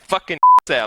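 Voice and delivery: loud, high, laughing victory yell; excited shout. Retold or imitated. Shouting at the race as the bet lands, with a laugh. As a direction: yell it as your horse comes in. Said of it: "What the fuck are you shouting about".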